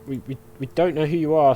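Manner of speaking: normal talking voice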